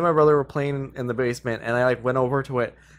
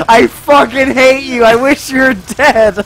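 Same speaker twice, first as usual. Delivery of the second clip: high, loud, slow mock fury; laugh-angry brother shouting; angry while laughing; 10 semitones higher, 9 dB louder, slower than usual. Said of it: "but he was so angry".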